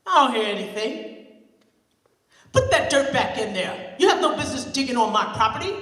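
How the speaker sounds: put-on loud stern old man; loud gruff old man; gruff neighbor. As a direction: order kids off his land. Mister Kelly.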